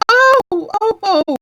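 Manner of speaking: panicked queen yelps; queen's screams. Acted. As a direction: shriek again, steam-whistle style. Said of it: "Her screams were so exact".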